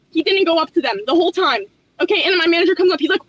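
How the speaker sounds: ordinary talk, nothing marked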